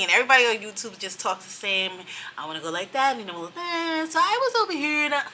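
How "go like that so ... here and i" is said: higher sing-song vlogger lilt, playful; mock YouTuber voice. Mother teasing YouTubers' voices, high sing-song mockery. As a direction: poke fun at the vlogger singsong. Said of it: "no, I ain't talking like that".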